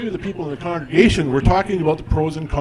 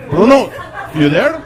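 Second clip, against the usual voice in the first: put-on much louder high Italian-accented call; calling into phone; 6 semitones higher, 7 dB louder than usual. Dad yelling into the phone, loud Italian accent. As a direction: shout down the line for Bruno. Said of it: "voicemail on my phone that says".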